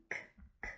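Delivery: "k" as a short hard k sound; letter sound. Shown as a demonstration. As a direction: say "k" as the sound, not the letter name. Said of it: "cat begins with the letter C".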